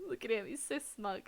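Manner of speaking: ordinary talk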